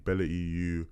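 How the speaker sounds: ordinary talk, nothing marked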